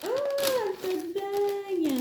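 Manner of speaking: high, slow, drawn-out delighted oh; delighted surprise. Real, not acted. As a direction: stretch it out in happy surprise. Discovers a gift in the parcel.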